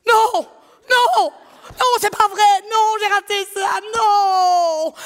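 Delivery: high, loud cry of disbelief; outraged kid shout. Retold or imitated. A kid at school, devastated at missing the episode, shouting. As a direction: yell no like a kid who missed it.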